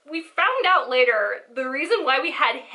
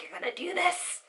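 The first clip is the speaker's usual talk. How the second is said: put-on quiet drunk slur; 5 semitones higher, 9 dB quieter than usual. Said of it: "it was drunk person being like".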